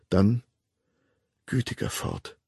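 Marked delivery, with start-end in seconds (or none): 1.5-2.4 softly whispered to herself; a private, barely voiced sigh of a prayer; murmured whisper. A narrator's reading. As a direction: breathe the words under the breath. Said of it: "murmelte sie".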